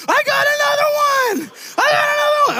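very high, delighted shout; squealing little girl. Retold or imitated. Niece calling to her uncle, very high and delighted. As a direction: scream it with joy like a small child.

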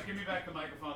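ordinary talk, nothing marked

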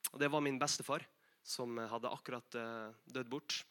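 softly, with halting pauses over the loss; quiet, slowing down as it gets hard to say; struggling to tell. Real, not acted. Preacher telling the congregation of grandfather's death, struggling softly. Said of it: "Jeg kjenner det er litt vanskelig å fortelle nå".

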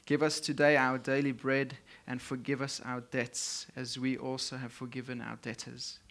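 ordinary talk

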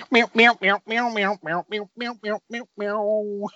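high wah guitar meows; bad movie dubbing. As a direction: sing the seventies porn riff. Mimicking porn guitar music.

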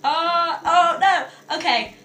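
loud, high, embarrassed groaning; flustered dismay. Real, not acted. Co-host reading her texts on air, flustered. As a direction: wail it, mortified by each new message.

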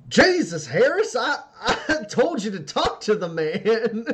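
high, loud, slow exasperation breaking into laughter; laughing put-on woman's voice; exasperated laughing woman. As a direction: scold him while cracking up.

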